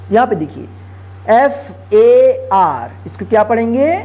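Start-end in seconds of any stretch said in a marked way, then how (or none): 1.3-2.9 letters spelled out slowly one by one; slow spelling. Shown as a demonstration. Teacher spells word letter by letter.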